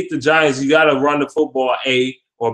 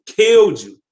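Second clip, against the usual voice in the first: ordinary talk, nothing marked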